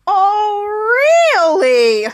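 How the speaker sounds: drawn-out "oh", high then falling; exaggerated, stretched-out reaction; mock disbelief. Retold reaction to bath answer.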